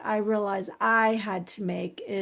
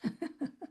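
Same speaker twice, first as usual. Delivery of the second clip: soft, breathy chuckle before answering; rueful laugh; 7 semitones higher, 11 dB quieter than usual. Real, not acted. Mother asked how the kids coped, soft rueful laugh. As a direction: laugh quietly at a hard question. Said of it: "I'm laughing 'cause that was a".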